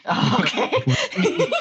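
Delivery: loud, drawn-out laughing reply. Real, not acted. Player reacts to GM's laughter.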